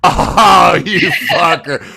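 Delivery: long, loud "oh" reacting to a joke; mock-outraged cry at a groaner; loud outburst. Real, not acted.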